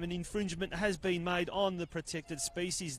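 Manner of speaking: ordinary talk, nothing marked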